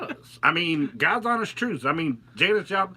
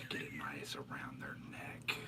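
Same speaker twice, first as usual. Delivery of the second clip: creepy hushed whisper; serial killer whisper; 13 semitones lower, 20 dB quieter than usual. Pretend. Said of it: "I sound like a fucking serial killer".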